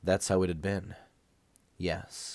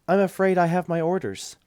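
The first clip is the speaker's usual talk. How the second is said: voiced nurse; 10 semitones higher, 6 dB louder than usual. A narrator's reading.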